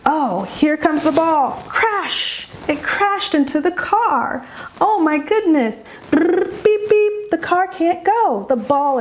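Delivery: high, excited sing-song play voice; animated child-directed voice; playful mom. Shown as a demonstration. Models mom's auditory hook in play.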